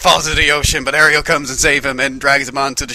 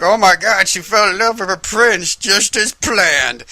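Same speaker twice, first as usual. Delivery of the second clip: high slow scheming gloat; mock villain voice; 7 semitones higher, 4 dB louder than usual. Ursula gloats over her plan, slow gleeful villain. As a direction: savor just as planned. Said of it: "was all like".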